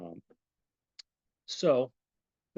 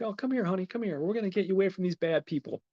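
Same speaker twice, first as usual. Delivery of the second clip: high-pitched, imitated coaxing voice; gentle coaxing; 8 semitones higher, faster than usual. Jules to the timid little girl, high coaxing. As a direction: coax a timid child, pitched well up.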